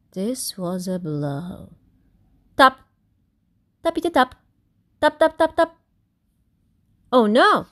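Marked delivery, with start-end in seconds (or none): 2.6-5.8 tapping rhythm. Acted. Woodpecker tapping sound. 7.1-7.7 alarmed cry. Acted. Crowd alarmed.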